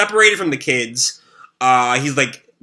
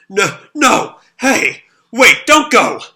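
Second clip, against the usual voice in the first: higher and louder, pleading her not to go; desperate shout; 8 semitones higher, 5 dB louder, slower than usual. Acted. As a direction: call out in panic as she slips away. Shiro as Yuri dies.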